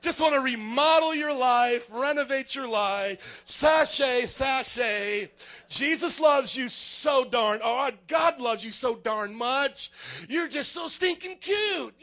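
slow, loud, lilting mocking voice; effeminate mock Jesus. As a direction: camp it up like a TV remodeler. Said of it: "talked a little bit effeminate".

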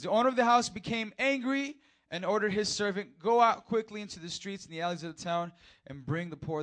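loud, high fiery preaching; full-throttle preacher; preaching at full force. Acted. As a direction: thunder it from the pulpit.

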